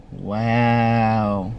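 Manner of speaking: low, drawn-out wow of disbelief; stunned wow. Retold or imitated. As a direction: let it sink in, low and level. Stunned reaction to friend's betrayal.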